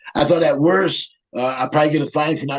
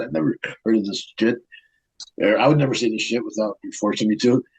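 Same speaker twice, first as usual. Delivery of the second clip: ordinary delivery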